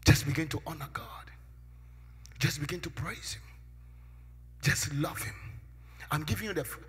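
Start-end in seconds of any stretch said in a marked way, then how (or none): 2.4-5.3 breathy whispered line after the preaching; hushed whisper. Real, not acted. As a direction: drop to a whisper for the congregation. Sudden hushed line in sermon.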